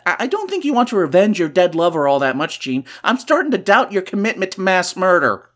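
ordinary talk, nothing marked

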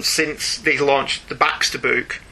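ordinary delivery

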